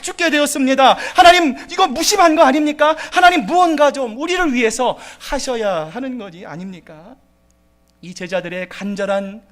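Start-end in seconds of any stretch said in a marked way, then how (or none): 0.0-7.1 higher, louder desperate complaint; complaining cry. Retold or imitated. Disciples crying to Jesus in the storm, complaining loudly. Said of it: "불만이 섞인 목소리로 부르짖습니다".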